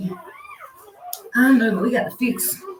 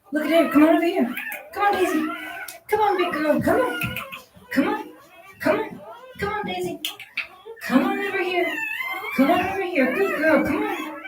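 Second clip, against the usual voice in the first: high sing-song calling to the dog; urging a heavy mother dog to follow her puppies, high and coaxing; 11 semitones higher than usual. Real, not acted. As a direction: coax her over in a high voice.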